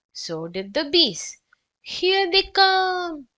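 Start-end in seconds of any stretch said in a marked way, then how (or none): 1.8-3.3 slow, much higher moan. Acted. Arthur sees the bees follow inside, slow moan. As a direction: drag it out in dread. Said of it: "moaned Arthur".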